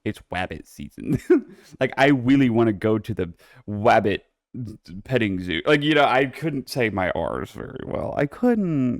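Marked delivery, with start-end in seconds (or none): 0.0-1.2 "wabbit" with a w for the r; says w for r. Retold or imitated. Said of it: "I used to say my R's as W's". 2.0-4.2 "wabbit" with a w for the r; says w for r. Retold or imitated. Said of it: "I used to say my R's as W's".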